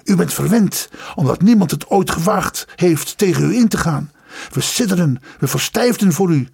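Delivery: heated, high-pitched accusations; firing off words. A narrator's reading. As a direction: hurl each insult separately, mounting in pitch. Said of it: "vuurde als het ware losse woorden af". (losse woorden woedend afvuren)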